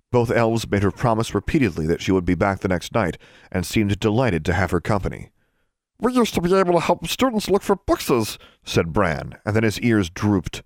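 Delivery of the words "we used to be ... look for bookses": high little elf voice, matter-of-fact; a chirpy servant elf with odd grammar; house elf. Acted. Poe the elf telling Alexandra his view, high elf voice.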